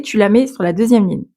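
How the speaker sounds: ordinary talk, nothing marked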